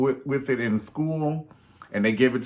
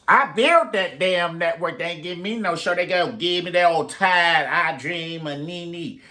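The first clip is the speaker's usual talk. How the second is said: mock indignant Nene; 5 semitones higher than usual. Nene complaining the network gave her no show, mock indignant. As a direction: rant like a slighted star. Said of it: "Nene's like".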